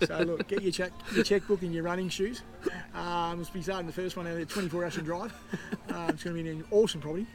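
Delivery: hammed-up real estate ad read; mock agent pitch. Real estate agent plugging listings to listeners, hammed-up ad read. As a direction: sell the listings like a cheesy agent. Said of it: "mock real estate pitch".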